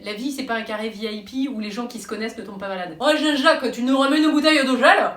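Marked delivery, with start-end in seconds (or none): 3.0-5.1 loud party shout over music; calling out loud. Retold or imitated. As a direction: yell it across a club, louder. Calling out in a nightclub.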